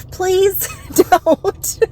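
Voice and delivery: laughing through the words; breaking into laughter. Retold or imitated. Thinking it, then cracking up.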